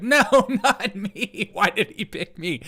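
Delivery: high, drawn-out whine with a laugh; reluctant little girl. Retold or imitated. Girl to her mom about Biden picking her, mock dismay. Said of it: "she's like".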